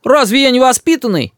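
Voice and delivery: put-on high little-boy astonishment; surprised. A narrator's reading. Boy questions grandmother about his manners, surprised. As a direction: ask it high, taken aback. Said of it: "удивляется Рис".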